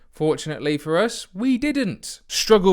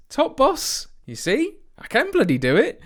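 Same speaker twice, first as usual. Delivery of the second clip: high, pleased gloating; smug boast; 12 semitones higher than usual. Real, not acted. To viewers, proud of the manager award, gloating high. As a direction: crow about it, voice up high.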